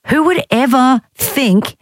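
high, drawn-out disbelief; incredulous. Real, not acted. Host scoffing at a turkey theory, incredulous.

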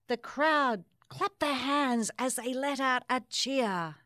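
put-on lively rhyming read-aloud for children; story narration. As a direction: bounce through the rhymes like a storybook. Reads picture book aloud.

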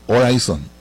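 "Horizon" said the English way, like "oh-RYE-zon"; English pronunciation. Shown as a demonstration. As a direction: stretch out "Horizon" in an English accent. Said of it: "Nótese la pronunciación".

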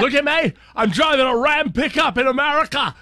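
high, hammed-up Scottish accent; Scottish fan showing off his pickup, mock Scottish accent. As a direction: play the giddy Scotsman in a Scottish accent, bragging about his truck. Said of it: "he's got a Scottish accent".

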